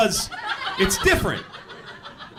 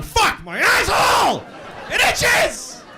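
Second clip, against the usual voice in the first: mock anguished yell; slower than usual. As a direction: scream it like the itch is unbearable. Yelling about itchy asshole.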